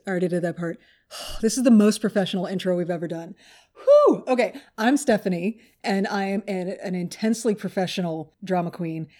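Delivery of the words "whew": high, loud exhale; relieved whew. Real, not acted. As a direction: blow out the nerves with a whoop. Shakes off the botched intro.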